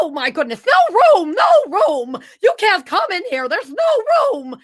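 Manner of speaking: high, frantic character voice; shrill-pitched panic at unwelcome guests; Mad Hatter voice. Acted. Mad Hatter turning guests away from his tea party, high and agitated. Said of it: "what's his voice?".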